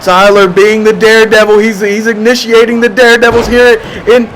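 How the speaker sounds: rising excited shout; wrestling commentator. Real, not acted. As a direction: build to a yelled oh my God. Daredevil dive, commentator erupts.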